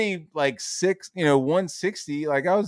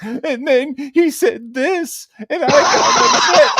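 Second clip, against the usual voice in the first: high, slow, loud fake wailing; hammed-up waterworks, pitched way up; mock crying; 13 semitones higher, 8 dB louder, slower than usual. Stepmom playing victim to dad, exaggerated mock crying. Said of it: "she would turn on the waterworks".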